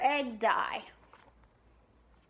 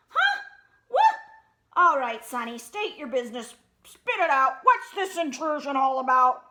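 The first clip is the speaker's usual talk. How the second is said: loud, cranky boss rabbit; grumpy old rabbit; 2 semitones higher, 8 dB louder than usual. Acted. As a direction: bark at the intruder. Boss Bunny woken, gruff.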